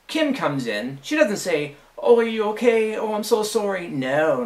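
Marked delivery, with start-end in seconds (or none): 2.0-3.9 high-pitched imitation of sympathy; mock concern. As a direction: act out the fussing apology she never gave, pitched up. Imagined concern she never showed.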